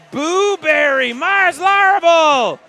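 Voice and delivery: slow, loud, high shout. Retold or imitated. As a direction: belt out the names slowly. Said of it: "Mars Larrable says".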